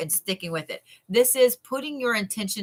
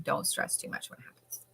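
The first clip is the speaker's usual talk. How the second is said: whispered reassurance; 6 semitones lower, 12 dB quieter than usual. Real, not acted.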